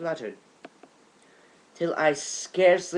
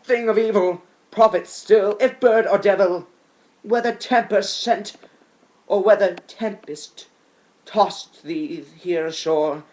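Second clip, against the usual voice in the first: higher and louder, urgent imploring; impassioned plea; 4 semitones higher, 6 dB louder than usual. Acted. Poet imploring the raven, loud and higher. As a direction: beg the bird for an answer. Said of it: "said I".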